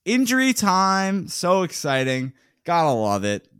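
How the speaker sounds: slow, mock-excited sarcasm; sarcastic. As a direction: drag it out with heavy sarcasm. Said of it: "heavy sarcasm there".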